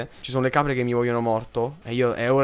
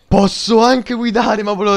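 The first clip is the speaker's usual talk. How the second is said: excited; 7 semitones higher, 10 dB louder than usual. Real, not acted. Finds he can drive.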